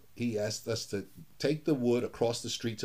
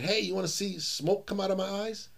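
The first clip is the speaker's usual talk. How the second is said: teasing grandpa voice; 7 semitones higher than usual. Retold or imitated. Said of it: "and he said".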